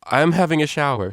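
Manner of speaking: called out over her shoulder; calling out. Acted. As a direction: call it out as she rushes off. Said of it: "she called".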